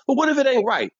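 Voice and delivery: high, loud doubtful question; challenging customer. Retold or imitated. Customer challenging the barber in a high loud voice. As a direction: push back on the barber.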